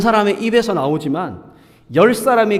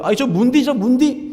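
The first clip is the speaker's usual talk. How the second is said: imitating a Gyeongsang local tossing a higher-pitched insult; mock Gyeongsang teasing, pitched up; playful Gyeongsang dialect name-calling, raised higher; Gyeongsang dialect jeer; 7 semitones higher than usual. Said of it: "경상도에서는 뭐가 있을까요".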